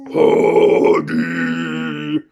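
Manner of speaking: low growling bear bellow; greedy bear roar. Acted. Big nasty bear shaking the hive for honey.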